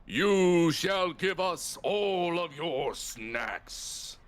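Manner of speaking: slow, grand mock-menacing demand; dark lord. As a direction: declare it slowly like a tyrant. GM voices lion winged creature.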